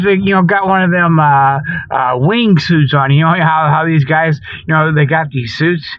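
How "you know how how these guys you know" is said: usual speaking voice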